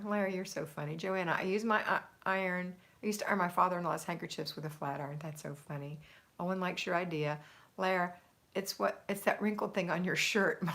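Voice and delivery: ordinary talk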